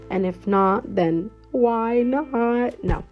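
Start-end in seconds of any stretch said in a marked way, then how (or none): none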